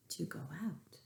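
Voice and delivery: whisper. A narrator's reading. Afraid to go out.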